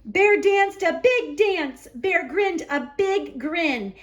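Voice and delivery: usual speaking voice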